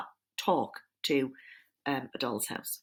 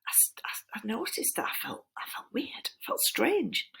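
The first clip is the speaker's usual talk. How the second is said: whisper; 6 semitones higher, 5 dB quieter than usual. Acted. Serpent describing strange feeling.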